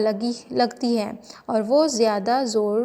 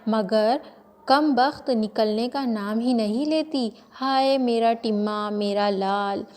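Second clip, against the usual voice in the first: ordinary delivery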